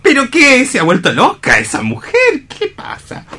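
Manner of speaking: high, half-laughing disbelief; shocked high outburst. Acted. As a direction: blurt it out, astonished. Hans shocked at Cora's behavior.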